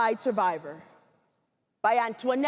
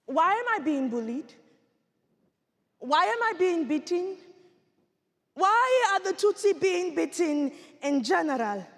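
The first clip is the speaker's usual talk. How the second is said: building, higher-pitched demand for answers; pleading questions; 7 semitones higher than usual. Acted. Six-year-old asking why she is bullied, pleading. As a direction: press each why harder than the last. Child's escalating questions, acted.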